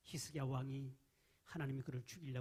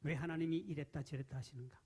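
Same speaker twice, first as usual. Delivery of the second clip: normal talking voice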